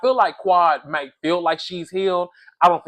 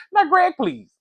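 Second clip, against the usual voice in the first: high, exasperated "Greg, please"; incredulous scoff; 7 semitones higher, 5 dB louder than usual. Real, not acted. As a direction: brush off his boast in disbelief. Scoffing at Greg's fight claim.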